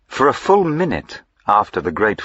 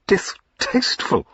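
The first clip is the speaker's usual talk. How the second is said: much higher, breathless disbelieving "Distasteful?"; shocked cry; 13 semitones higher than usual. A narrator's reading. Rejected squire echoes her insult, shocked. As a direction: echo the word in stunned outrage. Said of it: "cried Mister Betterson".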